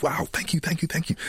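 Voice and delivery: high-pitched imitation of a grateful producer; mimicked overjoyed gratitude; gushing thanks. Mimics producer gushing after the take.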